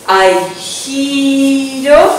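"giro" stretched long, rising at the end; slow, sustained dance cue; drawn-out cue. Shown as a demonstration. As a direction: draw out "giro" through the whole turn. Stretching the word along the turn.

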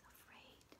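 fearful hushed whisper; scared whisper. Acted. Scared name of feeling.

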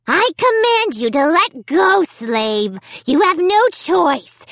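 high, commanding dummy squeal. A narrator's reading. Pinned dummy demanding obedience from Amy, squealing. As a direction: squeal the orders, frantic to be obeyed. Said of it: "he squealed".

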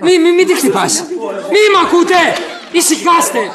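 high, loud pleas to stop; alarmed shouting. Acted. Man pleads with the fighting pair to stop, alarmed shouting. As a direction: shout over a brawl to break it up.

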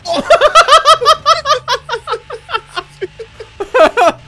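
high, loud cackling laugh; loud long laugh. Real, not acted. Gamer laughing at the bad cop, loud. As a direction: let a big laugh roll out.

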